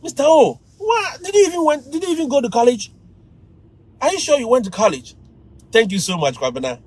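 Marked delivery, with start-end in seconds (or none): 0.0-5.2 high-pitched mocking student challenge; disrespectful student. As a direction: needle the teacher in a raised student voice. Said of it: "They wanted to disrespect me".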